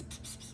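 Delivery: unvoiced pss pss pss of scheming gremlins; voiceless whispery pss sounds; gremlin whispering. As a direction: hiss it like little gremlins.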